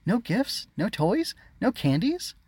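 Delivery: put-on very high childish whine; each item a pleading question; whining. A narrator's reading. Disappointed sister to brother, high whine. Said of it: "Harmony whined".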